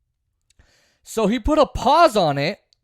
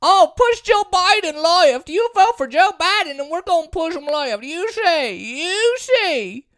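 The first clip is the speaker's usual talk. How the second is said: higher, slow, smug mock pleading; mocking liberal voice; 8 semitones higher than usual. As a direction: parody their naive promise. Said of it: "the people who said".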